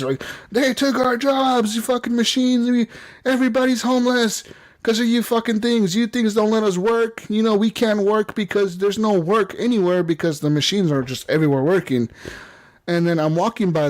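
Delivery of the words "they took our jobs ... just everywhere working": high-pitched mock rant at the machines; protester. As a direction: imitate an aggrieved picketer, voice pitched right up. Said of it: "they're out there protesting".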